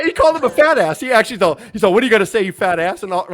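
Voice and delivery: amused outburst. Real, not acted. Co-host gleefully retelling the wrestler's fat-ass insult, amused outburst. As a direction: burst out in high, loud disbelief.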